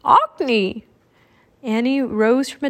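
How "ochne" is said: high squawk falling away; raven caw. A narrator's reading. Jack calling Annie in raven talk, cawing. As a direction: caw the name like a bird.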